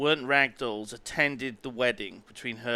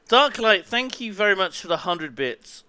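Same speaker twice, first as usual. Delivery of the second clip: excited; 6 semitones higher, 6 dB louder than usual. Real, not acted. Thanking a donor excitedly.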